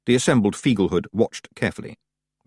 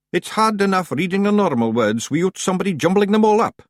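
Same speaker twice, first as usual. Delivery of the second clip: high, loud Scots wee-man voice; high Scots pictsie; 10 semitones higher, 3 dB louder than usual. Acted. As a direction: lecture the clan like a cocky wee chief. Rob answers Yan.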